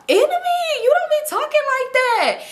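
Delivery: very high, squealed mock shock; mock disbelief. Teasing the friend's man over how he talks, squealed mock disbelief. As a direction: squeal it as if scandalized by him.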